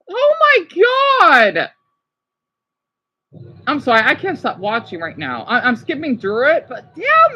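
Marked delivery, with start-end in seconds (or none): 0.1-1.7 high, loud and slow "oh my God"; awed gasp. Real, not acted. Vlogger overwhelmed by the parade, high slow awe. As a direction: stretch it out in amazement.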